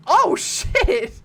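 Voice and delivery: loud, high exclamation; startled outburst. Real, not acted. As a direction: swear in sudden shock. Startled by game.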